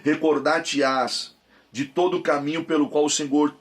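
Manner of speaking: solemn, measured Bible reading; scripture reading. Acted. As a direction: read the verses with weight. Reads Deuteronomy aloud.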